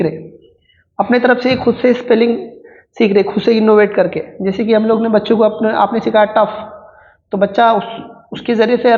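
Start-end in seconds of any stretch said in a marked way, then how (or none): none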